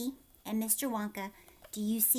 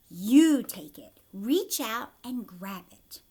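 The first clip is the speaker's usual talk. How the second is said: eager urging; 8 dB louder, slower than usual. Acted. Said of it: "cried Mister Wonka".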